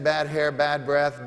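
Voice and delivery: ordinary talk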